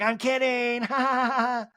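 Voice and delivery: high put-on voice with fake laugh; Kyle. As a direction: sing out the joke, then mock-cackle. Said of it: "Kyle's like".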